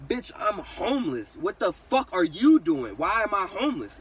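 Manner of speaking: loud, high, slow retold outburst; yelling back. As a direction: yell back at mom, punching the words. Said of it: "I'm sitting there yelling at her".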